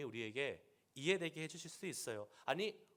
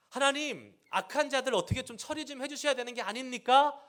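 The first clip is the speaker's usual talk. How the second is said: raised, louder pleading cry to God; pleading Jeremiah; 9 semitones higher, 6 dB louder than usual. Retold or imitated. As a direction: beg God for justice, voice lifted high. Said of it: "라는 이러한 질문을 지금 하고 있는데".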